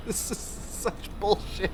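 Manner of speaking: slow, unvoiced laugh through the words; breathy laughing complaint. Real, not acted. Streamer laughing in frustration, breathy and slow. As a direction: laugh it out under the breath in defeat.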